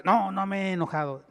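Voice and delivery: denying anger a little too loudly. Shown as a demonstration. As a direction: raise the voice while denying you're angry. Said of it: "ya está levantando la voz".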